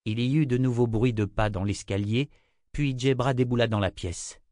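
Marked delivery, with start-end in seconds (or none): none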